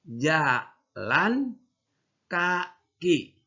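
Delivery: "Jalan" rising, a pause, then "kaki" falling; right reading. Shown as a demonstration. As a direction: keep the words apart, with a clear pause. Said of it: "Jangan dibaca jalan kaki, ya, salah".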